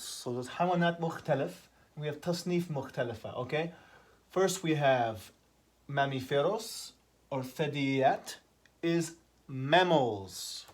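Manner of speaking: ordinary delivery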